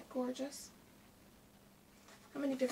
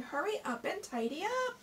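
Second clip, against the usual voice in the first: higher, mild hammed-up hurry call; mock shout; 7 semitones higher than usual. Hare telling Tortoise to tidy, mild mock shout. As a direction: hurry him, lightly yelled. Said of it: "Hare is shouting".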